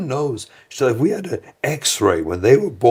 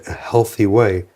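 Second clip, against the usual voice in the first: usual speaking voice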